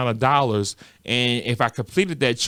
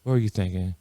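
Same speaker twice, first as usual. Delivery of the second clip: quiet scolding; 4 semitones lower, 6 dB quieter than usual. Retold or imitated. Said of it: "He's like".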